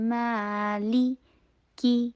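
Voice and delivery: مالی long "maa", then clean "lee"; drawn-out recited "maa-lee" on مالی; clear ee sound. Shown as a demonstration. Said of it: "لی کی sound آئے".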